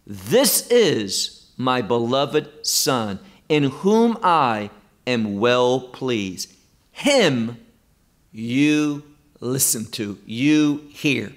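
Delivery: slow, weighty quote with HIM set apart; emphatic. Acted. Preacher quoting God from the cloud, slow and emphatic. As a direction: spell it out slowly, leaning on HIM and YOU. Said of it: "He's emphasizing this".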